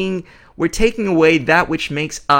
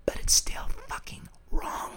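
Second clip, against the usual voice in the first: hushed, breathless whisper; whispered blunt conclusion; 13 dB quieter than usual. Real, not acted. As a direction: drop to a whisper for the verdict. Hushed punchline after the stress remark.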